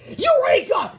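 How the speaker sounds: very high, loud shout of triumph. A narrator's reading. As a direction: cry out like an inventor whose experiment worked. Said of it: "shouted Melvin".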